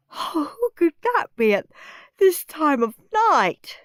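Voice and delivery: sleepy, yawning high voice. A narrator's reading. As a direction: speak through a yawn. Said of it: "yawned Mum".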